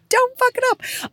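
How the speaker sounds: high, slow mock-desperate plea; panicky plea. Nervous self-talk before family sees.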